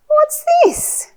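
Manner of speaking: very high, singsong question; excited curiosity. Shown as a demonstration. Pointing at the picture for a little one, excited curiosity. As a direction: ask with big wonder, high up.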